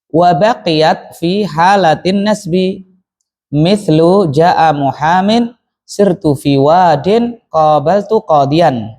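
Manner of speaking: teacher's slow, careful reading of the text; slow model reading. Shown as a demonstration. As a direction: read the passage slowly for the class to follow. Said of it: "teacher models the text". (guru membaca teks dengan pelan)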